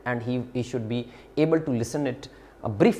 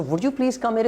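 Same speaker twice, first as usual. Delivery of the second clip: higher, gentle courteous ask; polite request; 9 semitones higher than usual. Shown as a demonstration. Teacher models the polite version for the class, gently. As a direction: ask as a kind favour. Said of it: "is more polite in my opinion".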